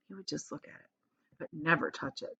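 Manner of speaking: soft storyteller hush. Acted. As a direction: draw the listener in quietly. Charlie only looks at the bar.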